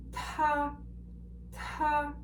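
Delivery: "ta" held level and high, aspirated T; flat first tone. Shown as a demonstration. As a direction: keep "ta" on one high steady note with a puff of air. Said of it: "it is the flat tone".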